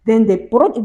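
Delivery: ordinary talk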